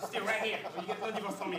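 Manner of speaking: high, loud shouted command; angry ultimatum. Acted. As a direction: shout to stop your wife leaving. Husband threatens wife leaving.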